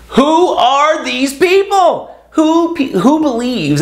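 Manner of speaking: high, slow, drawn-out disbelief; incredulous. Real, not acted. Ranting to viewers about ghosters, high and slow in disbelief. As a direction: ask it like you can't believe they exist.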